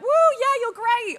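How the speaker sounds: high, whooping fan voice; imitated gushing cheer. Imitating viewers praising a video, gushing cheer.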